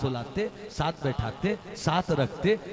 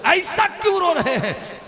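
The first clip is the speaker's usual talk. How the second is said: much higher exclamation; 8 dB louder than usual. Acted. Preacher's rising exclamation.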